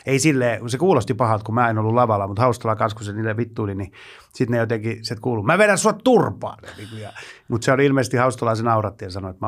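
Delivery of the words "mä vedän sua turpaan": slow, high mock threat; threatening heckler. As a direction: imitate a drunk heckler's threat. (uhkaava humalainen, hitaasti ja korkealta)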